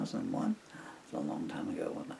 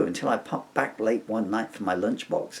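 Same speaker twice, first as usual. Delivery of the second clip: ordinary delivery, nothing marked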